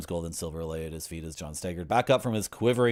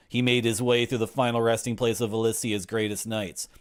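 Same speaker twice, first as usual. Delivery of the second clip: normal talking voice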